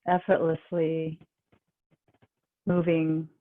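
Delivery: calm, even, unhurried relaxation guide; guided meditation. Real, not acted. Yoga teacher guiding the class into relaxation, calm guided meditation.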